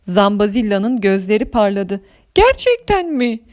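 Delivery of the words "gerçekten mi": tiz, heyecanlı soru; inanamadan, ince sesle sor; umutlu şaşkınlık. Acted. Gözleri parlayan Zambazilla. (sevinçli şaşkınlık)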